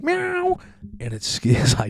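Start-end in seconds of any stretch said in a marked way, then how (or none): 0.0-0.6 very high squeaky mock meow; cat meow. Host imitating the backyard cat that ambushes him, very high meow. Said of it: "coming down the stairs at me like".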